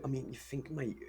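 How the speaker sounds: normal talking voice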